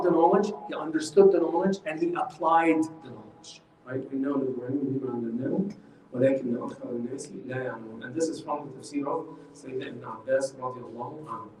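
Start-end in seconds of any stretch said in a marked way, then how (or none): 4.1-8.0 soft, low Arabic recitation; Quran recitation. Acted. Teacher repeats the verse for the class, softly reciting.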